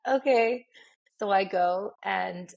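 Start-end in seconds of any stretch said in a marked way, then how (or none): 0.1-0.6 high, loud eager okay. Retold or imitated. Young artist agreeing to the test run, high and eager. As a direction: chirp it out eagerly. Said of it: "So I was like".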